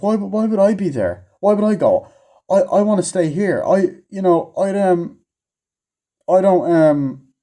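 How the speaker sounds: a lightly voiced, dithering nerd who won't go; mock reluctant scientist. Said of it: "He's like".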